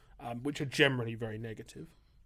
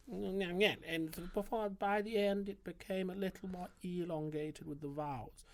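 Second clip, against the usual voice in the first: higher, mock royal voice; slow, lifted and stretched-out vowels; a light take on the late Queen's speech; imitating the Queen; 7 semitones higher than usual. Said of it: "her voice got sort of started like this".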